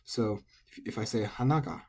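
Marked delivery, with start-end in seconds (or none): 1.2-1.8 "hanaga" with high "na" and low "ga"; final-high accent. Shown as a demonstration. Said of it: "any particles attach low".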